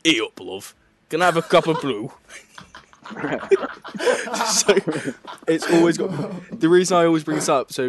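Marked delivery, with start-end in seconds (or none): 0.0-2.1 lightly put-on Northern English; casual mock Northern English accent; Northern English impression. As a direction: order a brew like a Northerner. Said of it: "as I would expect a Northerner to order".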